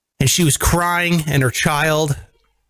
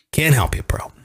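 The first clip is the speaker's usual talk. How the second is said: whispered, dismissive repeat; whispered aside; 3 semitones lower than usual. Real, not acted. Repeating the brush-off to the host, in a whisper. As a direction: breathe it out, no voice.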